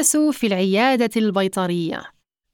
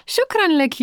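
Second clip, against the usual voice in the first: high-pitched, delighted thanks; happy; 9 semitones higher than usual. A narrator's reading. Lara thanking Ida for lending her the belt, happily. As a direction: let the pleasure lift your pitch. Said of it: "فتقول لارا بسعادة".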